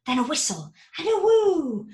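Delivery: ordinary delivery, nothing marked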